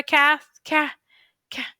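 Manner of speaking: stumbling over castle, then getting it; sounding out a word. Shown as a demonstration. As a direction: demo sounding out a hard word. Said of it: "try sounding them out".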